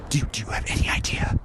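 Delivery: breathy whispered threat; menacing whisper. Real, not acted. Vlogger whispers to his viewers how pissed off he is. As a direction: drop to a whisper to unsettle them.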